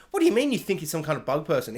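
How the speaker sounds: normal talking voice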